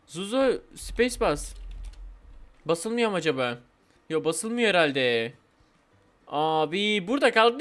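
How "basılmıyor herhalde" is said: ordinary delivery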